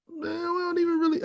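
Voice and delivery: whiny protest. Retold or imitated. Whiny reply to wife.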